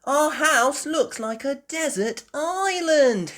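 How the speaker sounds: put-on high, loud, slow, laughing little girl; laughing Peppa. A narrator's reading. Peppa looking at all the water, high laughing voice. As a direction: giggle through it like a delighted piglet. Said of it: "Peppa laughed".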